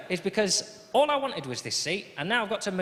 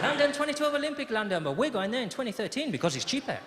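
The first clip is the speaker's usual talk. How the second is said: put-on Spanish accent; 6 semitones higher than usual. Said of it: "dad continuing in accent".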